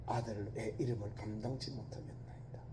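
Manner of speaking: the son whispers his unworthiness to the father; humble whisper. Acted. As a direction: breathe it out in shame. Son's humble confession to father.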